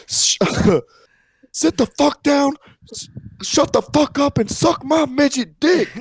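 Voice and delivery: high-pitched hollered impression of a wrestler; Alabama midget wrestler. Quoting the wrestler at Fred's, high mock holler. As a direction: yell it high, as an imitated little wrestler. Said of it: "the one at Fred's that said".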